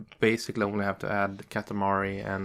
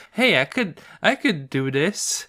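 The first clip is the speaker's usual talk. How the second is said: lightly imitated eager offer, pitched up; 5 semitones higher, 6 dB louder than usual. Retelling partner's offer to take over the project, mildly eager. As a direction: pipe up as someone volunteering, higher at the start. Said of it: "she was like".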